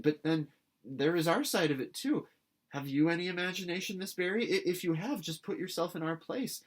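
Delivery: high, eager appeal; passionate plea. A narrator's reading. Anne pleading with Miss Barry, impassioned. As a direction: plead with rising fervor.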